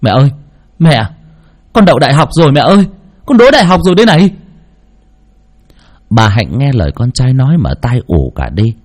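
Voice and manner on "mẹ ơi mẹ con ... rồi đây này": fast, higher, louder joyful outburst; cries out with joy. A narrator's reading. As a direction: blurt out the good news in a rush. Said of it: "ôm chầm lấy mẹ mà gào lên".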